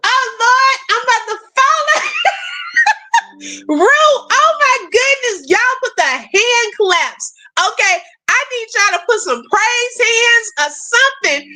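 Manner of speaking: high, giddy amazement with laughter breaking out; overjoyed. Real, not acted. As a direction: call the chat to celebrate, elated. Overjoyed at a super sticker.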